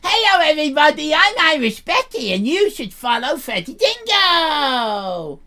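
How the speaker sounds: lightly voiced teen character host; Irish Becky. Irish Becky welcoming viewers to her live show. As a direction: announce the show as a brash teen.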